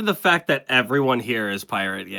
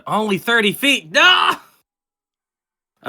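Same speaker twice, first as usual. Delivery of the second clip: dismayed shout; 7 semitones higher, much slower than usual. Real, not acted. Player dismayed at spell range.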